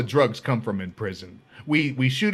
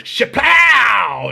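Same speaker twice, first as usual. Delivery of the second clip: higher, much louder shapow burst; loud shapow effect; 9 semitones higher, 9 dB louder than usual. Retold or imitated. The gang leader shooting him heroin, loud higher shapow. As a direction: punch the sound out like a gunshot.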